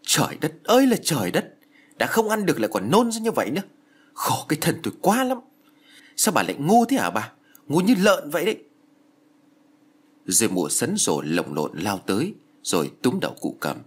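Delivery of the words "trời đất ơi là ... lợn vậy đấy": furious roar. Acted. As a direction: scream abuse in disgust at the old woman. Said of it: "Mụ Diễm gầm lên".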